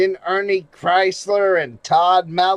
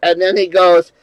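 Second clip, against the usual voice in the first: ordinary talk, nothing marked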